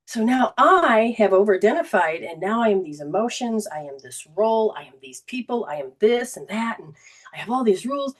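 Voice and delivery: ordinary talk, nothing marked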